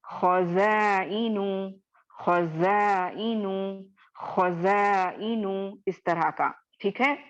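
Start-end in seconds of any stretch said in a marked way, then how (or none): 0.0-5.8 खज़ाइनु slow with a thick, rounded खौ; heavy Quranic खज़ाइनु drawn out; heavy reading. Shown as a demonstration. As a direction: model खज़ाइनु slowly for students to repeat. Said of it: "मोटा करके पढ़ना।".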